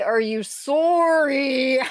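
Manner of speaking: "sorry" as a long, high "soooor-ee", mock Canadian; Canadian accent. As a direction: stretch a round Canadian O in "sorry". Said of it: "when Canadians say "sorry".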